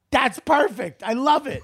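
high-pitched, thrilled approval; delighted young actor. Retold or imitated. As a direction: imitate an actor loving the take. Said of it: "The kid goes like this".

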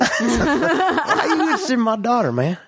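Matthew McConaughey. Retold or imitated. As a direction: draw out the dad's question like a dazed dude. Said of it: "totally dazed and confused Matthew McConaughey".

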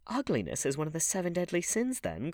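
high-pitched exclamation; crying out. A narrator's reading. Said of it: "cried the Duchess".

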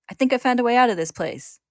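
high, loud, urgent appeal; calling out. A narrator's reading. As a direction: call out for an enemy's help. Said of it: "he calls".

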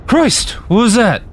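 slurred, sleepy. A narrator's reading. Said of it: "asked in a slurred voice, heavy with sleep".

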